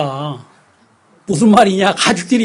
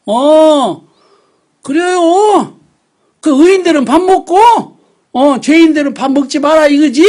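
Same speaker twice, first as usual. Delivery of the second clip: high, loud sarcastic comeback; indignant retort; 7 semitones higher, 6 dB louder than usual. Retold or imitated. Preacher replaying his sarcastic retort to family. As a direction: fire back in mock outrage.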